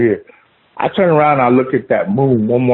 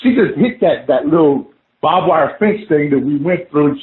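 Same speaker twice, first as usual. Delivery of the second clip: normal talking voice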